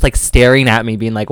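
ordinary talk, nothing marked

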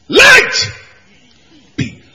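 a high, loud call of "Light"; commanding God. Acted. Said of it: "You remember?".

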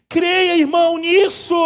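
loud, high urging; preaching exhortation. Acted. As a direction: call out to the brother.

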